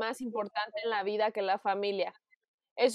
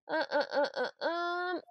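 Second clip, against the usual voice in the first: wheezy gasps after choking; 5 semitones higher, 6 dB quieter, slower than usual. Real, not acted. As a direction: wheeze for breath after coughing. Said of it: "me ahogo".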